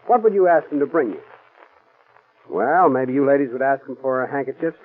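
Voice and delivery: usual speaking voice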